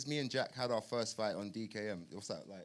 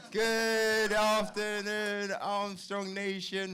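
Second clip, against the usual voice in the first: hyped shout-out; 10 semitones higher, 10 dB louder than usual. Real, not acted. Hyped shout-out to fan nations.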